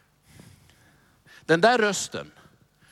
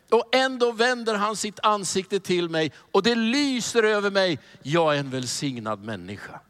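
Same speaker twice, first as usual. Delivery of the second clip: ordinary talk, nothing marked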